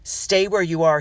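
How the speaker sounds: loud, commanding order; father ordering his daughter to stay put; firm order. A narrator's reading. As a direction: stop them in their tracks. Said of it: "he ordered".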